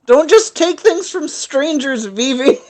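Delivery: loud, high-pitched playful telling-off; mock scolding. Player scolds party member for trusting strangers, mock scolding.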